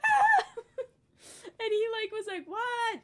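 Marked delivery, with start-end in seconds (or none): 0.1-0.6 high, loud mock scream; panicked scream. Child with head stuck, screaming in panic. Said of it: "freaking out". 2.5-3.0 high, raised-voice query; calling back. Retold or imitated. Dad answering his screaming child, calling back.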